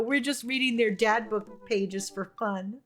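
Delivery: ordinary talk, nothing marked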